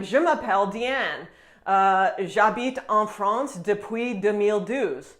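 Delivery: slow, heavily accented French, each word laboured; an English speaker's overdone accent in French; exaggerated foreign accent in French. Shown as a demonstration. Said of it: "I'll just exaggerate an accent".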